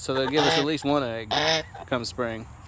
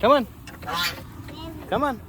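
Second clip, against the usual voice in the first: high coaxing call; 7 semitones higher, faster than usual. Real, not acted. Calling to the dog.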